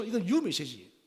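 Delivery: usual speaking voice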